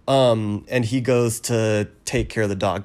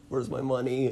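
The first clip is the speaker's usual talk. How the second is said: quiet demand; 3 semitones higher, 9 dB quieter than usual. Retold or imitated. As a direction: ask it under your breath. Maimed man demands money.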